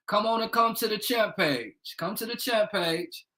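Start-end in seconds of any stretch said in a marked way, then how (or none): none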